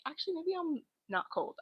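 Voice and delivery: high, soft mock thought; quoting herself. Telling chat her own thought in a high, soft voice. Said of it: "I'm like".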